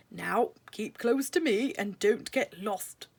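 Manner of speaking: slow, lightly voiced fatherly mouse; a father warning his daughter, lightly voiced; Lord Woodmouse. A narrator's reading. Father mouse to Primrose, gentle slow warning. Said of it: "he said".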